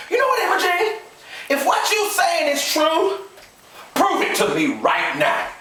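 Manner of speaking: slow, high-pitched demand; angry challenge. Acted. Husband demanding wife prove it, angrily. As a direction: draw the challenge out word by word.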